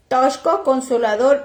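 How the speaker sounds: usual speaking voice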